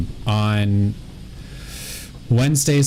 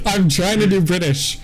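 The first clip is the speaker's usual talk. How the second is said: mock British; 9 semitones higher than usual. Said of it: "Why are you Scottish".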